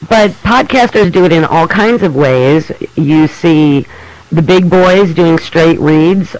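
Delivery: usual speaking voice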